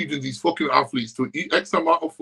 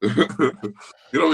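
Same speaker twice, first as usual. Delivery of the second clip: ordinary talk, nothing marked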